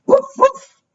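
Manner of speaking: short, high woofs; dog bark. Acted. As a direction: bark like a little storybook dog. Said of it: "barked the dog".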